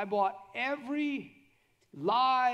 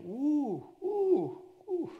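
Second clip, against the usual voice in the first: high, louder whoops of relief; relieved "ooh"; 8 semitones higher, 3 dB louder, much slower than usual. Acted. Preacher acts out feeling the burden lift, relieved oohs. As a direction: let out rising, lightened oohs.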